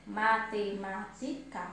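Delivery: MA-TE-MÁ-TI-CA in separate, even beats; measured syllable count on MATEMÁTICA. Shown as a demonstration. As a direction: slowly break MATEMÁTICA into its syllables. Teacher sounds out the syllables.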